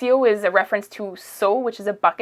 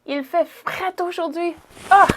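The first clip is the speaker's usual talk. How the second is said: mock shivering; 9 semitones higher than usual. Shown as a demonstration. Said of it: "Il, you say".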